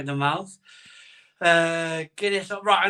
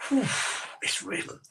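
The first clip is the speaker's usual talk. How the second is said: breathless exhale; 7 semitones higher, 8 dB quieter than usual. Real, not acted. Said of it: "I'm almost out of breath".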